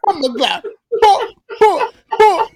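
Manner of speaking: hammed-up Jamaican exclamation, high and loud; joking Jamaican patois cry; mock Jamaican accent. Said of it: "I'm Jamaican".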